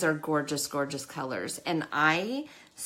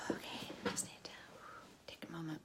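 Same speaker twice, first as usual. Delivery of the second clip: breathy whisper, much softer; whisper to herself; 17 dB quieter, faster than usual. Real, not acted. Pulling herself together on camera, soft whisper. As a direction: quietly talk yourself down. Composing herself after tearing up.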